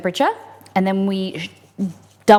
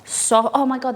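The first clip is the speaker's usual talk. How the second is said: ordinary talk, nothing marked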